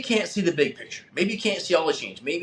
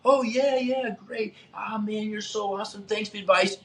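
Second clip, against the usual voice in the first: passive-aggressive thanks; 5 semitones higher than usual. Retold or imitated. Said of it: "what maybe we call a passive-aggressive person".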